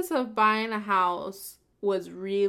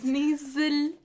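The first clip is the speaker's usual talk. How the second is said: Scottish word; 5 semitones higher than usual. Shown as a demonstration. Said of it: "when you actually say, when you say these words".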